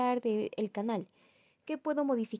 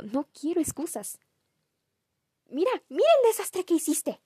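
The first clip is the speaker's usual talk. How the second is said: mom scolding; 7 semitones higher, 4 dB louder than usual. Retold or imitated.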